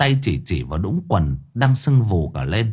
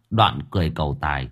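ordinary delivery

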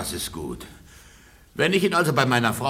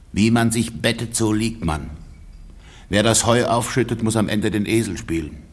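usual speaking voice

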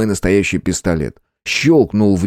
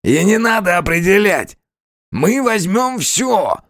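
nasal, nasty distorted robber; 11 semitones higher than usual. A narrator's reading. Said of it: "противный гнусавый голос".

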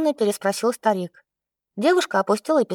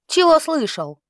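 higher, angry comeback; defiant snap; 6 semitones higher than usual. A narrator's reading. Настя repeats her insult's challenge, higher and defiant. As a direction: throw his question back at him. Heroine snaps back at him.

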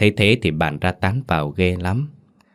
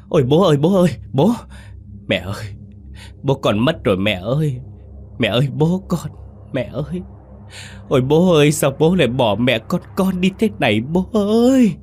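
wailing; 8 semitones higher than usual. Acted. Sons wailing to mother over their father's death, high anguished cries. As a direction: cry out in raw grief for the dead father. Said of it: "thì kêu lên".

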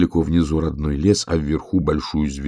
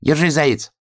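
high hedgehog call; high-pitched encouraging cry; hedgehog shouting; 10 semitones higher than usual. A narrator's reading. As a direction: call out in a raised little voice. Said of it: "крикнул ёжик".

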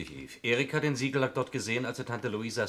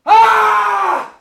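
a very high, loud scream of fright; piercing scream; 19 dB louder than usual. Acted. Man screams as the danger appears, piercing scream. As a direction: scream in sudden terror. Sudden alarm on stairs.

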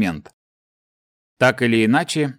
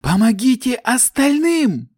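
high urgent slow shout; 11 semitones higher, slower than usual. A narrator's reading. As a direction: call out desperately to others. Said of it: "раздался крик Ивии".

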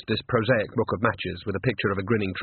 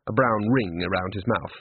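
plain reading voice

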